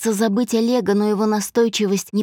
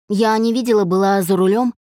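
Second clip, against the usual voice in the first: ordinary delivery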